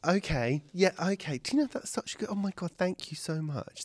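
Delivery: gushing excitement. Acted. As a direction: burst with delight. Cass gushes with gratitude.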